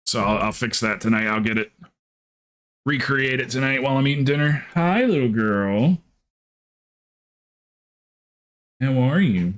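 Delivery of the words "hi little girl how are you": slow, sing-song pet voice. Real, not acted. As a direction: coo at a dog slowly. Talking sweetly to a pet dog.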